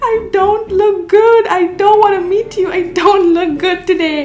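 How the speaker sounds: high, pinched panic at being spotted; mock horror, voice jumping up; dismayed oh no. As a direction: squeak out your dread. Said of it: "And I'm like".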